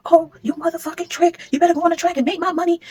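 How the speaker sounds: high, shrill-pitched mock scream of a furious mother; imitated high furious yelling; imitating angry yelling. Neighbor overheard yelling at baby's mother.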